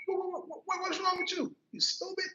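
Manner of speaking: imitating a high-pitched, stammering sister; excited stutter. Sister calling her crying brother stupid, excited stutter. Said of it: "She started too when she get excited".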